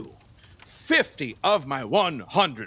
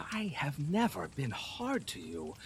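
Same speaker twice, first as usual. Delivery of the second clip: coaxing; 4 semitones lower, 11 dB quieter than usual. Acted. Herod coaxing Salome gently.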